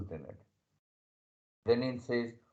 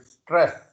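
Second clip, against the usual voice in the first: "stress" with the R leaned on; 3 semitones higher, 4 dB louder than usual. Shown as a demonstration. Teacher showing students a hard letter, stressed R in "stress". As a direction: put weight on the R in "stress". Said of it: "R is being stressed in this word stress".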